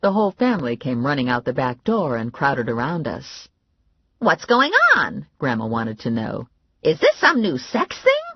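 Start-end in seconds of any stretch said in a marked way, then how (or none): 4.2-5.3 put-on higher, louder, slow curious old lady; nosy grandma asking; Grandma's voice. Grandma running out to the pile of bodies, loud and curious. 6.8-8.2 nosy grandma asking; Grandma's voice. Acted. Grandma running out to the pile of bodies, loud and curious.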